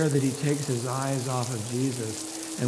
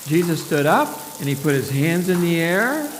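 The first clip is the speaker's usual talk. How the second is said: usual speaking voice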